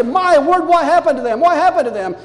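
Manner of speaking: loud, high-pitched acted alarm; alarmed grandparent. Grandparent jumps up at a sudden wail, acted alarm. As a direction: call out as if rushing to a wailing child. Startled grandparent rushing to crying child.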